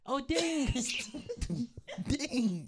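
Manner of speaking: high, giggly stoned voice; stoner voice. Pretend. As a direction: act baked and giddy. Acting high on Gorilla Glue.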